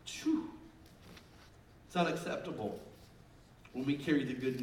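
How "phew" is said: short high "phew" of dismay; sobered puff of breath; exhaled whew. Real, not acted. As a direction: blow out a breath at the weight of it. Reacts to grave statistic.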